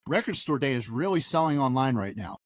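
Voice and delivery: usual speaking voice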